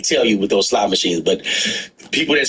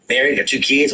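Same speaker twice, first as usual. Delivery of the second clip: ordinary talk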